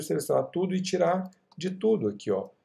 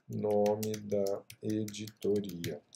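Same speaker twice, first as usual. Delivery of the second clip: low, soft, slow syllables; typing aloud; 6 semitones lower, 9 dB quieter, much slower than usual. Real, not acted. Teacher retyping the placeholder, softly and slowly. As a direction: murmur it slowly as you type.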